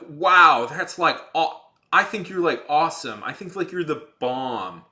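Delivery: mock over-the-top fan gushing; gushing comic character. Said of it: "they'll go like".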